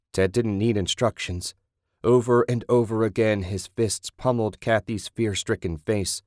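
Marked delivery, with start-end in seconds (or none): none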